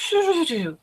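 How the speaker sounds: high whooshing noise falling away; imitated rippling rush. Shown as a demonstration. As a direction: voice a wave rippling down through the body. Imitates ripples going through body.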